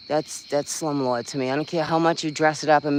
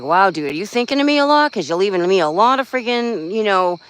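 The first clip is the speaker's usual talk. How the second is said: mock sarcastic; 4 semitones higher, 5 dB louder than usual. Sarcastic retort to the landlord.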